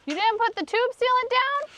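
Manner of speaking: high, incredulous question; surprised. Real, not acted. Partner forgot the sealant.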